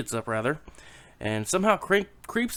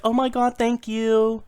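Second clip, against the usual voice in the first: high, louder, hammed-up gushing wife; gushing thanks; 9 semitones higher, 5 dB louder, slower than usual. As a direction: gush with exaggerated, high-pitched gratitude. Delighted wife thanking her husband.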